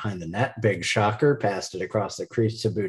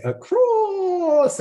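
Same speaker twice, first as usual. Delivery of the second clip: hammed-up film character impression, higher and hugely drawn out; H W Plainview; 5 dB louder than usual. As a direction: stretch ACROSS into a long theatrical cry. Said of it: "H W Plainview would say".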